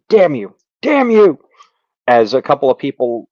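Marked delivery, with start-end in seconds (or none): 0.1-1.4 rising furious shout of damn you; the wounded villain curses them, louder each time; angry cry. Acted.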